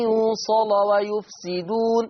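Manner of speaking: يوصل ويفسدون slow, lam of يوصل heavy, run on; heavy lam in joining. Shown as a demonstration. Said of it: "حكم اللام وصلا التغليظ".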